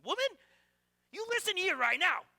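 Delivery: a pretend furious shout at his wife; mock angry outburst. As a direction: pretend to lay down the law to your wife.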